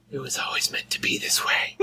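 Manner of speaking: hushed, solemn whisper; whispering back. Acted. Player looks deep into the dying foe's eyes, whispering back. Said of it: "and I whisper back".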